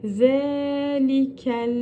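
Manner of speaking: Zelikel slow, tongue touching the upper teeth for the Z; lisped Z, drawn out. Shown as a demonstration. Teacher showing students the lisped Z, slowly. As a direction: stretch the Z of zelikel one beat. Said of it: "zelikel diye lamı tutturduk".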